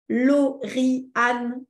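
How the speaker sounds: name split into separate syllables; evenly chopped robotic syllables; robot talk. Shown as a demonstration. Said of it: "je parle comme un robot".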